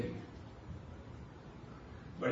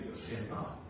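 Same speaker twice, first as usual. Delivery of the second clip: whispered; 4 semitones lower, 8 dB quieter than usual. Shown as a demonstration. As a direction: whisper "but sin not" with no voice, soft. Repeats command, drops to whisper.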